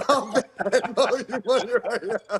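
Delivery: high, slow quote through laughter; laughing, high-pitched mock offer; laughing retelling. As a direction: crack up while imitating the plea. Retold desperate offer, teller cracking up.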